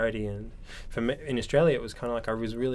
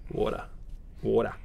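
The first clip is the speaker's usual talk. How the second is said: Australian accent. Shown as a demonstration. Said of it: "just go".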